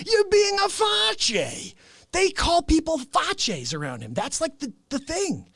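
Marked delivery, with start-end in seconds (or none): 0.1-1.7 high, slow mock yell; mock shout. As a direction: bellow the insult. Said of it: "They're like".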